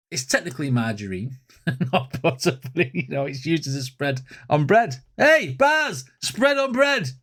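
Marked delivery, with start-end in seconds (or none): 5.2-7.1 high excited hype. Real, not acted. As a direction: hype up the bar. Hyping a lyric.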